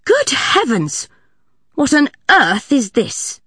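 slow, high startled matron; high, slow alarmed exclamation; shocked. A narrator's reading. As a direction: recoil at what she sees. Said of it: "in a completely different voice".

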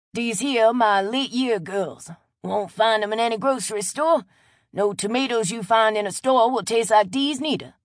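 put-on old farmer proud of his late tomatoes; proud old Cajun man. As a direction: show off your tomatoes proudly. Chet shows off tomatoes.

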